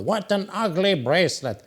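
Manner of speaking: half-hearted imitation of shouting; mild mock outcry. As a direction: imitate outraged critics without raising the volume. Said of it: "they were screaming or shouting out".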